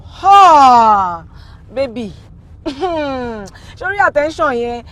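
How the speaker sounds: loud, drawn-out swooning cry; gushing exclamation. Acted. Friend raving about a handsome rich man, excited.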